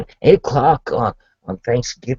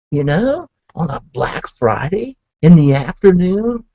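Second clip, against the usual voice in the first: ordinary talk, nothing marked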